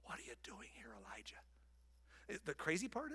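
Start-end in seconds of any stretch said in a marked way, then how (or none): 0.0-1.4 hushed soft repeat; quiet tender God voice; gentle whisper. Acted. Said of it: "That's the gentle whisper".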